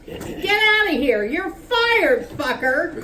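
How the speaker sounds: angry boss yelling in the workroom; shouted. Acted. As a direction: fire him at the top of your voice. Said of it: "Cleve shouted".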